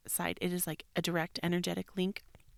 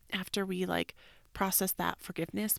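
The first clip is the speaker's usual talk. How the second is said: normal talking voice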